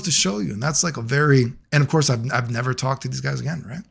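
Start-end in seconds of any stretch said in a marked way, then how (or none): none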